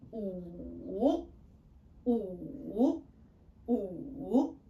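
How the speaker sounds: "wu" drawn out, down first then up; dipping third tone. Shown as a demonstration. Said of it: "going down first and then up".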